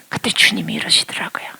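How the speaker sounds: normal talking voice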